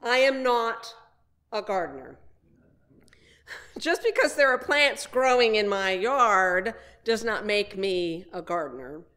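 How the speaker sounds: ordinary delivery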